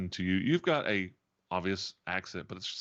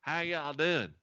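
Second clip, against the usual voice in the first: exaggerated Tennessee Southern, pitched up; hammed-up Southerner from Tennessee; mock Southern accent; 6 semitones higher than usual. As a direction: lay on a thick fake Southern accent. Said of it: "like being a Southern and person".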